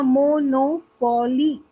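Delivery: Monopoly slowly as मो-नो-पो-ली; syllable by syllable. Shown as a demonstration. Teacher listing four-syllable words, syllable by syllable. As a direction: draw monopoly out in four beats. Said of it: "four syllables वाले word".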